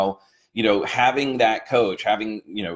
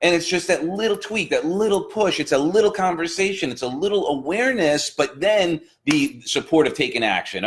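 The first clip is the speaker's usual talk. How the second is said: pitched much higher, eager motivational push; fired-up coach voice, much higher; heightened inspiration; 9 semitones higher than usual. Acted. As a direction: lift it up like a pep talk to a client.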